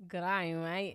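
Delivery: amateur Aussie greeting; Australian accent. Shown as a demonstration.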